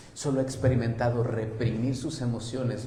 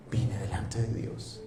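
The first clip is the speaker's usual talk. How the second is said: soft, slow whispered confession; 4 semitones lower, 5 dB quieter, slower than usual. Acted. Psalmist pouring his heart out before God, whispered. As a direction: breathe it like a secret prayer.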